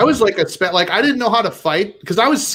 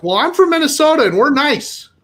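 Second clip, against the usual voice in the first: higher-pitched mock indignation; comically affronted, pitched up; indignant protest; 8 semitones higher, 4 dB louder than usual. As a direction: protest it, hurt and insistent. Acted protest to the front desk.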